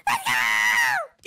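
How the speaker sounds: high-pitched excited yell; excited cheer. Pretend. Brother yelling at his game, excited cheer.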